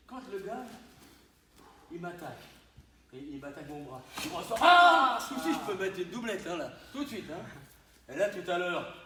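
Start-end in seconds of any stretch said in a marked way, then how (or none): 4.6-5.2 sharp loud high kiai; kiai shout. Real, not acted. Instructor demonstrating a strike to the class, sharp kiai shout. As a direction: let out a full martial shout. Shout during technique demo.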